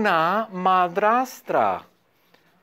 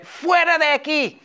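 stepmother shooing; 9 semitones higher than usual. Acted. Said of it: "le dice".

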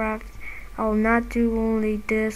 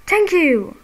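high, loud thank you; relieved cheer; 6 semitones higher, 6 dB louder than usual. Real, not acted. Gamer to the opponent who finally left, a loud relieved cheer.

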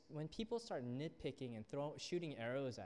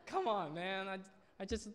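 higher, slow, laughing exasperation; exasperated; 6 semitones higher, 5 dB louder than usual. Retold or imitated. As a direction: throw your hands up, half laughing. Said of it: "I was like".

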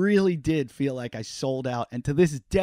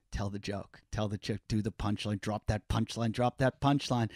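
inner voice; 4 semitones lower, 6 dB quieter than usual. Retold or imitated. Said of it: "every part of me is like".

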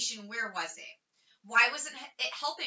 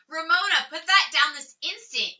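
higher, louder sharp order to her little sister; scolding; 6 semitones higher, 5 dB louder than usual. A narrator's reading. Beezus orders Ramona to drop the sucker, loud and sharp. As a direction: stop her right now.

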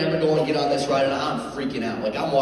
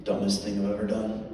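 ordinary talk, nothing marked

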